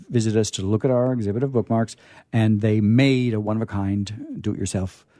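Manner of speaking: ordinary talk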